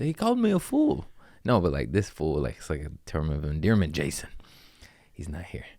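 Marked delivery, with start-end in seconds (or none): 0.0-1.1 offended friend. Retold or imitated. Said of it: "He said".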